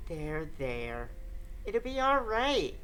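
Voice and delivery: soft, low soothing murmur; hushed tender reassurance; comforting. Acted. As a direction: comfort a crying friend gently. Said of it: "spoke to me in a very comforting voice".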